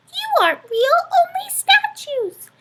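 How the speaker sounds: small mouse squeaking, puzzled; squeaky mouse. A narrator's reading. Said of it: "Mortimer squeaked".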